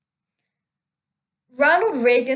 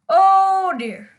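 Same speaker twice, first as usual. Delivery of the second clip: mock dismay; 6 semitones higher, 3 dB louder than usual.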